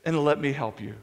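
low, slow divine command; voice of God. Acted. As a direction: drop low and unhurried, as God stepping in to help. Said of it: "where God is saying".

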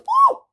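very high, loud squeal; shocked yelp. A narrator's reading. Baby gets cold cream blobbed on, shocked squeal. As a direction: yelp as if hit by something icy.

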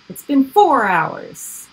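four hours said slow, high and loud; stress on hours. Real, not acted. Stressing how long painting took.

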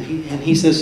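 ordinary talk, nothing marked

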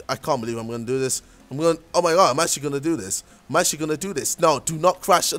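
normal talking voice

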